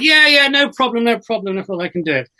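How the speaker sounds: retold tipsy, breezy assurance; breezy overconfidence. Drunk graduate assures the drama boss he can, breezy. Drunk self accepting accent challenge.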